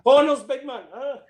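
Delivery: high, loud, laughing jeer at a pal being dragged out; laughing taunt. Retold or imitated. Friend jeers at him while he's dragged out, laughing. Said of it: "pointing at me laughing".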